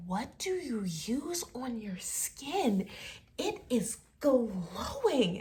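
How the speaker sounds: drawn-out gushing compliment; slow, swooping mock amazement; amazed admirer. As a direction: gush over it, stretching the words.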